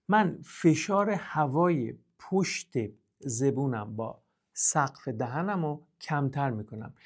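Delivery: ordinary delivery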